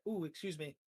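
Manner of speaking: normal talking voice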